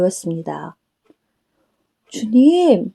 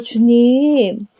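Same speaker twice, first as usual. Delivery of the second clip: usual speaking voice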